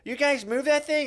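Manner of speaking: high, slow mock-irritated demand; nasty driver. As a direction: imitate a testy driver calling out. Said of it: "comes in fucking kinda nasty".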